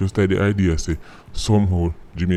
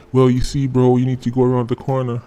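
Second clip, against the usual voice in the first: higher, louder fake American accent; put-on American accent; 4 semitones higher, 4 dB louder than usual. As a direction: badman suddenly puts on an American accent to please the tourist. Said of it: "bad man find accent too".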